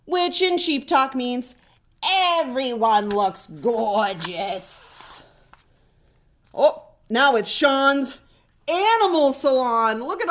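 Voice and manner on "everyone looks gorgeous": slow translation. Acted. Sheep talk translated.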